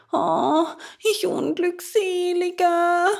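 high, loud puppet wail; theatrical high moaning; wailing lament. A narrator's reading. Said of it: "jammerte er".

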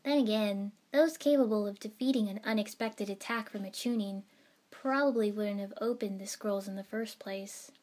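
ordinary delivery

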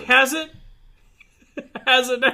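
high, loud mock doubt; teasing disbelief. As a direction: tease him about the great episode. Teasing cohost.

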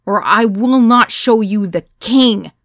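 loud, slow warning yell; yelling to regain control; screamed. A narrator's reading. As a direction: shout the threat over the noise. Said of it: "I screamed".

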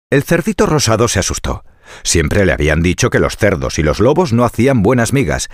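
usual speaking voice

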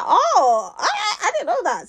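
high, drawn-out realisation; high-pitched, playful backtracking; mock surprise. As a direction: act out a sudden change of tune. Retold surprise on learning his job.

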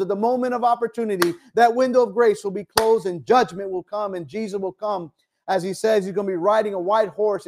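high, driving preacher's appeal, often fast; fiery preaching. Acted. A preacher warning his congregation that judgment is coming, urgent and fervent. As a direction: press the warning home with urgency.

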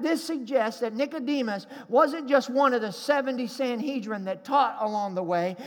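normal talking voice

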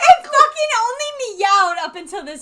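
loud, slow laughing disbelief; incredulous rant. Real, not acted. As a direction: rant with amused outrage. Ranting at talking cat.

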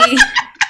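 sudden high laugh; high burst of laughter. Real, not acted. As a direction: break into high laughter at the memory. Bursts out laughing mid story.

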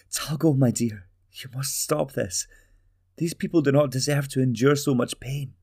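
a mother begging her son to stop; pleading mother. Acted.

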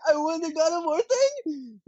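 high, slow, astonished question; can't believe your luck; disbelieving joy. Retold or imitated. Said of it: "And I was like".

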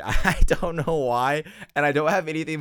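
laughing through the words; can't stop giggling. Real, not acted. Podcast host laughing at her own squeamishness.

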